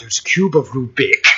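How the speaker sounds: put-on old horror star's voice on a silly phrase; Boris Karloff. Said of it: "I have to say it like that".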